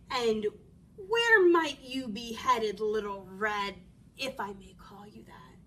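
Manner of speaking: slow, low-pitched wolf charm; sly courteous wolf. Acted.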